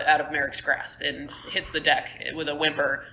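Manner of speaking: normal talking voice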